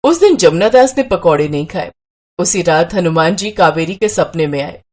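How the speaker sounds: ordinary delivery, nothing marked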